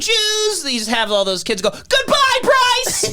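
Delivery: put-on little girl voice. Kids at the fight calling out to Bryce in a high child's voice. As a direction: wave them off like a shrill child crowd. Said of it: "that little girl that's going".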